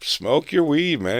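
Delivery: high, loud easygoing laugh-off; carefree cop. Retold or imitated. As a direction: wave it off, no big deal. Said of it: "And he was like".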